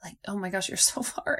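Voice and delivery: hushed whispered self-talk, trailing into a laugh; a dismayed whisper to her own stomach. Retold or imitated. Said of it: "I'm like".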